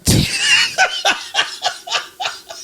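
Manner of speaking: high breathy laugh bursting out; burst of laughter. Real, not acted. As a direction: crack up at the joke. Laughs after naming [name].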